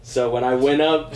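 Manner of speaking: ordinary talk, nothing marked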